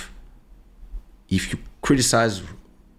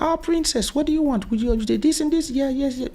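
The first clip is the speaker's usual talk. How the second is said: put-on much higher, sing-song voice fussing over a child; doting restaurant staff. Restaurant staff fussing over the daughter, high and doting. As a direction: gush over the little princess. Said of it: "everybody was like".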